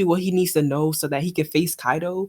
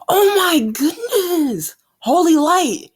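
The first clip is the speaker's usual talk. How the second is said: excited outcry; 10 semitones higher, 5 dB louder, slower than usual. Real, not acted. Reacting to the manga's big panel.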